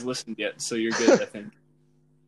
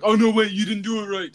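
higher, louder mimicked objection; mock protest; 7 semitones higher, 5 dB louder than usual. Host imitates co-hosts interrupting the recording, mock protest. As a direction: imitate friends butting in to object. Retells friends protesting the take.

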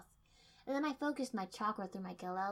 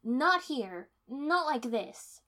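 loud, high, slow fury; furious; 5 semitones higher, 10 dB louder, much slower than usual. A narrator's reading. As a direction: spit it out slowly in rage. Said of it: "said furiously".

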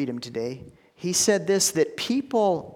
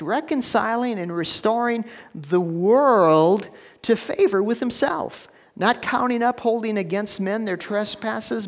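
preaching scripture; 5 semitones higher than usual. Acted. Preacher reads the Spirit's words to the church, preaching. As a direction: lift the verse up to the congregation.

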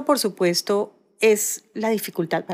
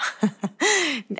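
high-pitched burst of laughter; real laugh; 7 semitones higher than usual. Real, not acted. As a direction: genuine amused laugh. Amused at her crystal anecdote.

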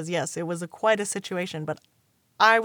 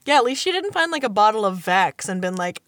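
ordinary talk

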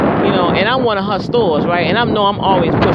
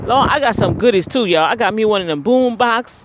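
ordinary delivery

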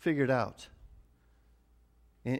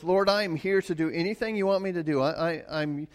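fervent prayer; 4 semitones higher, 4 dB louder than usual. Retold or imitated. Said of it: "In other words".

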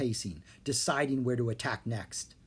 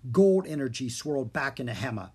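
plain reading voice

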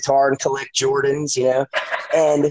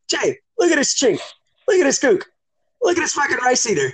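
imitating dad; 11 semitones higher than usual. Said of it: "And he's like, Hey".

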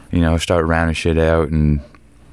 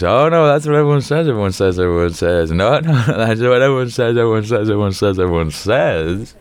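laughing mockery; 4 semitones higher than usual. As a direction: chant it back laughing. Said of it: "And we just".

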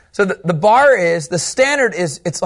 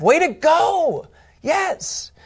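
high, slow hammed-up cheer, ending in a squeal; mock cheer; 10 semitones higher, much slower than usual. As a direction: cheer him on like a fan, jokingly.

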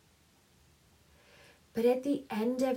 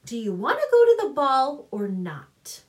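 louder, higher impatient demand; 4 semitones higher, 8 dB louder than usual. A narrator's reading. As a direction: push her to decide, fed up. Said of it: "stamped impatiently".

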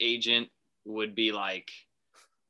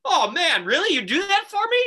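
much higher and louder, gushing delight; mock thrilled GM; 6 dB louder than usual. Falcons GM hearing the trade offer, hammed-up delight. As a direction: squeal like you can't believe the offer. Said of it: "the G M of the Falcons goes".

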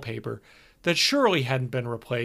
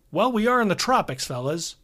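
higher, jovial manager voice; brash, cheerful tour manager; hearty road manager; 7 semitones higher, 3 dB louder than usual. Acted. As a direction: play the chummy older road manager. Hogarth voice again.

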